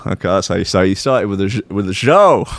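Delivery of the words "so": raised-pitch Dutch-accented opener; practising a Dutch accent. Shown as a demonstration. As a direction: try out the Dutch sound, high. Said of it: "Dutch accent?".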